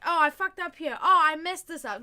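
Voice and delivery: acted oops, big and loud; exclaimed admission. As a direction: blurt out your mistake openly. Acts out owning up to mistakes.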